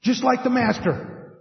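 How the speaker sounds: rising to high, excited amazement; growing astonishment. Retold or imitated. Disciples recognising the master, building to high excitement. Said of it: "and they're like".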